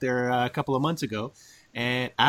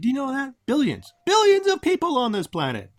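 excited; 10 semitones higher than usual. Acted. Host marvels at billions.

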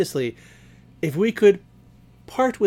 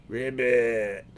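low frog croak; 7 semitones lower, 4 dB quieter than usual. Acted. Frog answers vampire question.

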